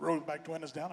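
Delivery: low, quiet imitation of an official; border agent. Agent ordering the driver at the crossing, low and quiet.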